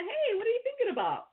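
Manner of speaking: light, high-pitched hey; cheerful check-in. Retold or imitated. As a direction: pop the question brightly at a friend. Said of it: "in the sense of like".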